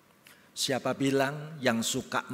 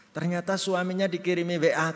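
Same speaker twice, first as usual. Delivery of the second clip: ordinary talk, nothing marked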